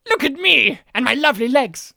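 loud booming theatrical voice; Brian Blessed voice. Retold or imitated. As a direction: boom it out, full of yourself. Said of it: "it's him being like".

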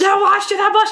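high, loud mock protest; mock outrage. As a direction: protest indignantly as the wronged man, hammed up. Mock-outraged quote of questioned man.

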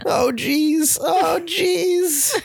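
high, wavering "oh jeez"; stammering nervous cartoon teen; Morty voice. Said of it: "You sounded like Morty for a second".

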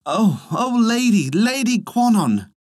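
high, wondering exclamation; awed thanks. A narrator's reading. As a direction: marvel aloud in grateful prayer. Peasant thanks goddess for the baby.

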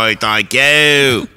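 slow, loud, drawn-out British thanks; high, hammed-up British holler; mock British accent. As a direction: stretch it out in cartoon British. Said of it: "I like when I go".

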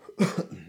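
rough chesty cough; coughing fit. Real, not acted. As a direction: hack it out. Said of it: "coughing up a lung over here".

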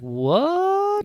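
high drawn-out mock "What?"; mock shock. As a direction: play the villain stunned in disbelief. Witch King shocked in retelling.

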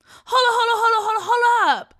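very high, loud rapid repeats; frantic protest. Acted. Brother fending off sister snatching candy, frantic protest.

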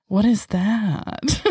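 drawn-out, puzzled "what is that"; slow puzzled question. Retold or imitated. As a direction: stretch each word in slow surprise. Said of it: "And she goes".

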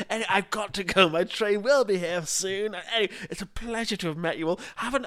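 high, theatrical farewell flourish; Christopher Biggins. Retold or imitated. Friend retelling Biggins taking leave of the team, high and grand. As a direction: imitate a grand celebrity announcing his exit. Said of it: "He's like".

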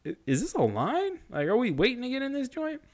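high-pitched questioning; puzzled asking. Retold or imitated. Asking girl in front if it's a line, high puzzled. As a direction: voice climbing in mock bewilderment. Asks girl ahead, retold.

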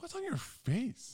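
high, slow alarmed question; mock alarm. To his partner about the rash, a high alarmed question. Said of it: "I was like".

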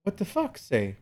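put-on high little-kid voice; mock toddler babble; imitating a toddler. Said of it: "He said".

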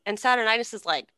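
ordinary talk, nothing marked

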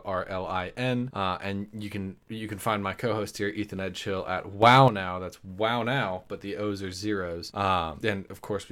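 ordinary talk, nothing marked

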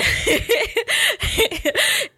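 bright high giggle, drawn out; high laugh. Real, not acted. Host giggling at her cohost's joke.

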